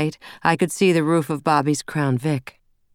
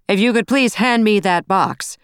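ordering; 6 semitones higher, 5 dB louder than usual. A narrator's reading. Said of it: "Bobby ordered".

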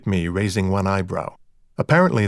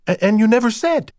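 high, louder stammered reproach; baffled protest; 11 semitones higher, 5 dB louder than usual. A narrator's reading. Rita reproaches Dexter for hiding a brother, baffled. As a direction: stumble into a bewildered accusation.

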